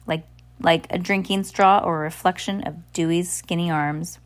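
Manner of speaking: plain reading voice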